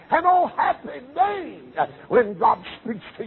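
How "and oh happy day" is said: joyful cry. Real, not acted. Preacher rejoicing when God speaks, high joyful cry. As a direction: lift it up in jubilant praise.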